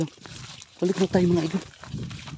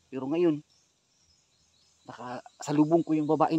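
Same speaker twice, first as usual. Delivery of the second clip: usual speaking voice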